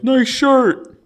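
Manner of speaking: high-pitched audience shout; mock heckler yell. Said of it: "someone in the audience might yell".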